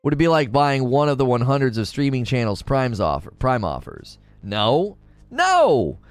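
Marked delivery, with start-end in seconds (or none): 5.3-6.0 higher, emphatic outburst; exasperated refusal. Real, not acted. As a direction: shoot the idea down, exasperated.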